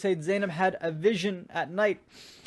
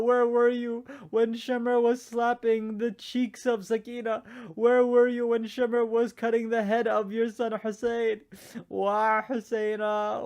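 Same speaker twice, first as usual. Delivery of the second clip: grieving lament; 3 semitones higher than usual. Acted. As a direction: cry out to the father who wasn't there. Daughter's lament to her father.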